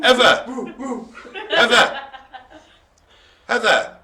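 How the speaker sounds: loud call across the room. Real, not acted. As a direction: call her name out loud. Calling out to Heather.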